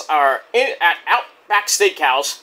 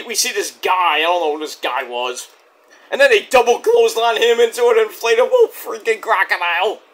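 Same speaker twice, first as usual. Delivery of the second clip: ordinary talk, nothing marked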